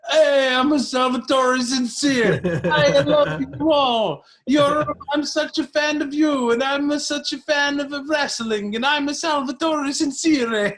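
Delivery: high, loud hammed-up Italian accent; Italian impression. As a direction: play a hokey Italian showman greeting his fans. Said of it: "I did the Italian impression".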